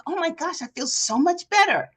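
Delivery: high, bright relieved exclaim; a client's grateful relief; relieved delight. Retold or imitated. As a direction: client telling coach she feels better. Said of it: "She's like".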